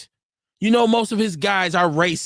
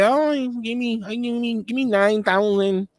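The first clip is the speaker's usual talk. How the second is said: a lightly put-on bad Mexican accent, slow at the end; horrible Mexican accent; 3 semitones higher, slower than usual. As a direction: haggle like a Mexican contractor in a hammed-up accent. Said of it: "That's a horrible Mexican accent".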